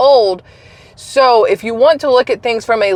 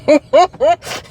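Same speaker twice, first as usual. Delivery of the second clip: helpless giggles at what was just heard; amused, higher-pitched laughing fit; burst of laughter; 5 semitones higher than usual. Real, not acted. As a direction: crack up laughing. Reacting to senator's graphic reading.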